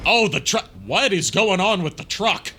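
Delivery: loud, startled exclamation at the truck; sudden surprise. Real, not acted. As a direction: react in shock as the truck stops. Truck suddenly stops in game.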